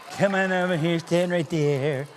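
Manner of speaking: usual speaking voice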